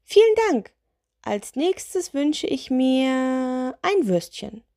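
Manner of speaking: high little witch, drawing out the wish. Acted. Witch wishes for a sausage, high little voice. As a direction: chirp the thanks high, then stretch the wish.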